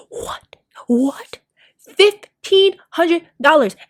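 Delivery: incredulous, drawn out; stunned by the price; shocked disbelief. Real, not acted.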